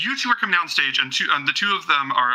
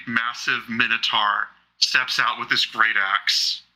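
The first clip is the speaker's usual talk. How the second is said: usual speaking voice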